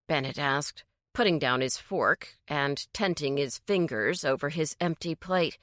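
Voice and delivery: ordinary delivery, nothing marked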